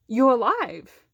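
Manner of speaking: retold shock. Vice principal seeing a former student, mildly shocked. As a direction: imitate someone taken aback. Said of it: "she just stood there in shock".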